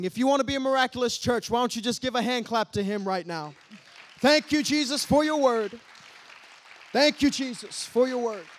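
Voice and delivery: high, loud, fast exhortation; preaching. Acted. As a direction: lift the church into praise. Rousing the church.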